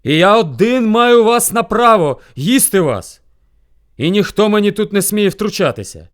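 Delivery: loud, high, emphatic claim; lordly bear shout. A narrator's reading. Rival bear claims sole right, imperious shout. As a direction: shout down any objection. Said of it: "кричить він".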